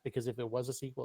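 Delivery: ordinary delivery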